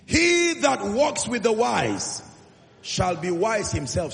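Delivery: loud, slow, emphatic quote; proclaiming scripture. Real, not acted. Preacher proclaims the proverb to his congregation, loud and slow. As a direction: boom out the proverb slowly.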